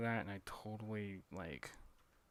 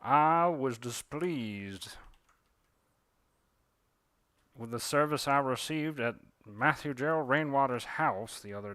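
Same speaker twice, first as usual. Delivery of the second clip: put-on a Southern cardinal complaining about a host, Southern accent; indignant Southern bird; 2 semitones higher, 5 dB louder than usual. A cardinal's complaint letter read in a haughty Southern accent. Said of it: "they'll send you a very strongly worded letter".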